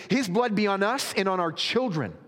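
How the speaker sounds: high, loud, emphatic reply; crowd answering. A narrator's reading. All the people answering Pilate, high and loud. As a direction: raise the voice as a whole crowd would. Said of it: "all the people answered".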